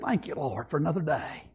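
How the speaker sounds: low, soft retold prayer; quiet thanks. Preacher retelling his morning thanks at the window, quiet and low. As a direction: drop down to a hushed, humble thanks.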